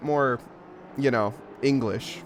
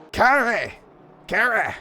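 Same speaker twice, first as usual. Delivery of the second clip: higher, punchy German exclamations; angry German accent; 7 semitones higher, 3 dB louder than usual. Shown as a demonstration. Commentator retrying a German corner name, mock-angry German accent. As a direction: say it more German, with sharp mock anger. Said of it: "Let me say it more German".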